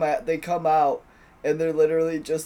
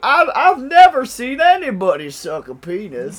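mock Jeff Foxworthy; 8 semitones higher, 10 dB louder than usual. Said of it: "He's like".

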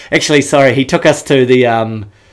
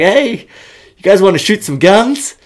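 local bro; 7 semitones higher than usual. Retold or imitated. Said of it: "I can't do the accent".

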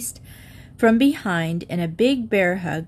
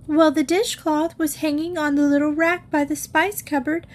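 put-on higher childlike explaining voice; little girl voice; 6 semitones higher than usual. A narrator's reading. Sharon explaining the broken cupboard to mother, childlike. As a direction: rattle it off like a guilty young girl.

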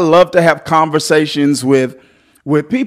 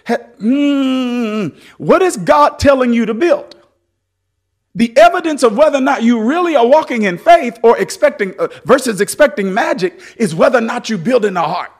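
preaching; 10 semitones higher than usual. Acted. Pastor preaching full force to the church, shouting. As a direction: preach it hard to the congregation.

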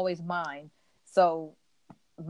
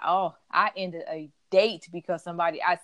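ordinary talk

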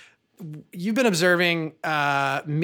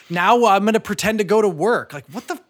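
higher-pitched, bewildered complaint; mock exasperation; 6 semitones higher, 4 dB louder than usual. Dad faced with pretend play, exasperated. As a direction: play up being lost and fed up.

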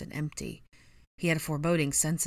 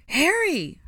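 very high, loud alarmed cry; 13 semitones higher, 8 dB louder than usual. A narrator's reading. Hermione bursts through the back door, crying out. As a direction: call his name urgently. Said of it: "Hermione cried".

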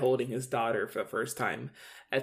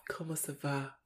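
put-on weird French accent; 14 dB quieter than usual. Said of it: "In his, like, weird".

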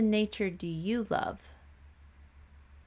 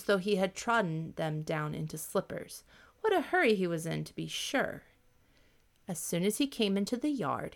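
plain reading voice

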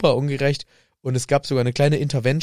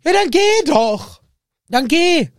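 much higher, loud yell to get out; furious shout; 6 dB louder, slower than usual. Acted. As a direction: scream at him to leave. Harry yells at Ron to leave.

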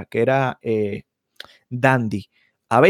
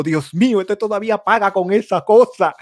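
higher incredulous mimicking voice; mock gasp of shocked onlookers; onlookers' mock disbelief; 5 semitones higher than usual. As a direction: play the shocked bystanders judging him. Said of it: "todo el mundo me miró".

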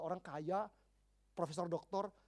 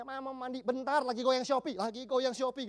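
mock distracted mom; 5 semitones higher, 7 dB louder than usual. Distracted mother brushes off child.

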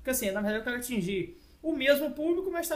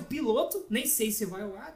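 ordinary talk

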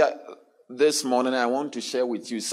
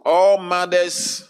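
loud, raised preacher's call; preaching at full force; 7 semitones higher, 6 dB louder than usual. Acted. Preaching opening to congregation.

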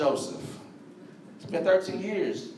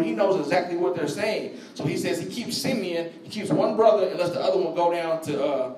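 usual speaking voice

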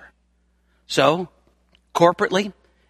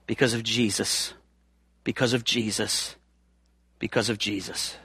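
much softer, lower, reverent; hushed repetition; 7 semitones lower, 10 dB quieter than usual. Acted. Preacher quietly answering why we bless the Lord. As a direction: let it fall to a near hush.

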